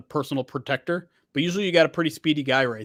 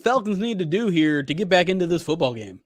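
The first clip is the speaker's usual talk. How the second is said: sports announcer voice; 4 semitones higher, 4 dB louder than usual. Pretend. Mock sportscast, calling to co-host.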